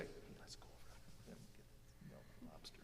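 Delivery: very soft, barely audible whisper; real whisper. Shown as a demonstration. Whispering to the person next to you, real whisper. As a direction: whisper privately, for one listener only. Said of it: "a real whisper like I would whisper".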